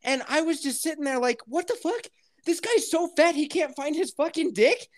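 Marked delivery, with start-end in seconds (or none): 1.5-4.9 high-pitched incredulous retelling; acted disbelief. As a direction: pitch it way up, baffled by the guy. Acts out his disbelief at post.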